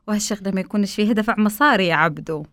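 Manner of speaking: usual speaking voice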